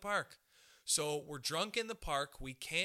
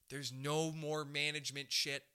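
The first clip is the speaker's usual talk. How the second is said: ordinary talk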